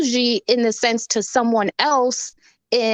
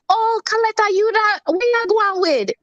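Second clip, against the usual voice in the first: much higher, warm Jamaican grandmother; grandma dropping the act; 12 semitones higher than usual. Retold or imitated. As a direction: switch suddenly to her homely Jamaican voice. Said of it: "And she'll be like".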